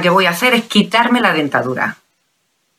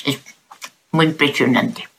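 talking without dentures; gummy, toothless mumble; toothless speech; 4 semitones lower, 4 dB quieter than usual. Real, not acted. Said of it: "Ya me he quitado la dentadura".

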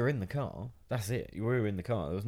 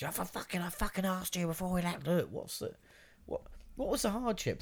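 higher-pitched, mock exasperated mum; fed-up mum; 8 semitones higher, 4 dB quieter than usual. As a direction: mimic a mother fed up with her kid. Mum scolding kid in car.

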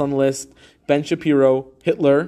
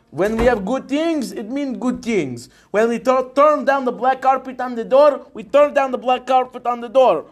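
fake Russian accent; 9 semitones higher than usual. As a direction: play a hammy Soviet leader in Russian accent. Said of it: "we were learning about Russians".

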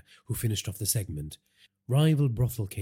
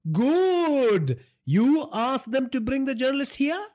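loud, very high voice of a pleased boss; domineering father; 5 dB louder, slower than usual. A narrator's reading. Father hears they got him, domineering and pleased. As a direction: boom out approval, then press the question high. Father reacts to son's news.

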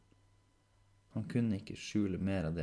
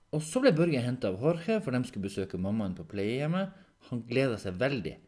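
louder, pitched-up eager recounting; eager Henrik; 5 semitones higher, 7 dB louder than usual. Acted. Henrik recounting Børge being picked up, eager. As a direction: recount it keenly to prove your memory.

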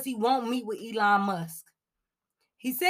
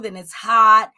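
then it's hot, with "hot" hit much louder; punched hot; 12 dB louder than usual. Acted. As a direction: slam the word "hot" loud. Listing seesaw weather.

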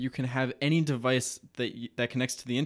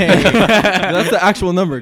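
louder words through a real laugh; 7 semitones higher, 16 dB louder, slower than usual. Real, not acted. Friend defends his phone-number joke, laughing. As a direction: laugh it out, slowly.

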